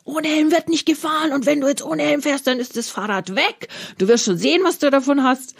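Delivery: high-pitched angry scolding, voice jumps way up; freaking out. Shown as a demonstration. Parent flipping out at child refusing helmet, high angry scolding. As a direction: snap at the kid like you've lost it. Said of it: "Ich kann jetzt ausflippen und sagen".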